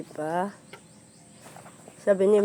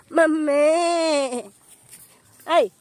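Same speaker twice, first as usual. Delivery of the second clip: 1 goat crying; 6 semitones higher, 6 dB louder, much slower than usual. Pretend. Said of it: "Sabi nila".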